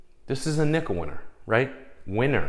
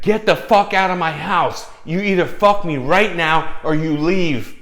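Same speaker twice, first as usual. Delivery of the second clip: yelled imitation of her ultimatum; retold furious shout; 9 semitones higher, 10 dB louder than usual. Woman throwing her date out of the house, furious shout. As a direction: act her out shouting, high and loud.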